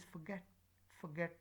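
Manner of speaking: "forget" with weak "for", stressed "get"; unstressed O. Shown as a demonstration. Teacher showing a weak form, unstressed O in "forget". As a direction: keep the O of "forget" light. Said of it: "this is a weak form".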